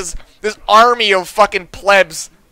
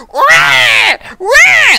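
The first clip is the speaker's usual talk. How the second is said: imitated kid wail; 5 dB louder than usual. Kids screaming in background.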